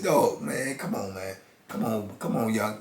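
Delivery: quiet "come on, man" to settle him; gentle hushed talk-down; hushed calming. Retold or imitated. Soothing him, much quieter.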